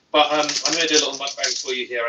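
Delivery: usual speaking voice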